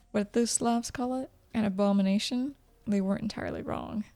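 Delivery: ordinary delivery, nothing marked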